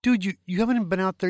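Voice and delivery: high, loud, fast disbelief; can't believe what he's hearing; answering his buddy's big news with shock; incredulous. Retold or imitated.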